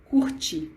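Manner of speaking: "curte" said alone and slowly, falling at the end; spelled-out word. Shown as a demonstration. Teacher models "curte" for followers, falling tune. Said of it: "C U R T I".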